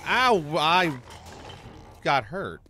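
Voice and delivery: sharp high yelp of pain. Real, not acted. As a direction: cry out as you get hit. Sudden hit while playing.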